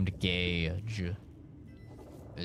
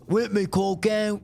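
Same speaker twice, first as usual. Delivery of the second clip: "Whip me cock out" repeated higher and louder in a mock Aussie voice; Australian accent; 8 semitones higher, 4 dB louder than usual. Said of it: "Australian's like".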